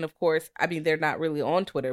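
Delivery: normal talking voice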